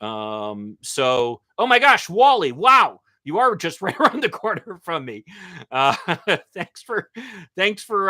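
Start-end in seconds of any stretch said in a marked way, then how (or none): 1.6-3.0 loud, high-pitched delighted surprise; real astonishment. Real, not acted. Host spots a viewer from just around the corner, astonished. 3.2-8.1 higher voice breaking into laughter; real amused laughter. Real, not acted. Host thanks a nearby viewer for joining, laughing.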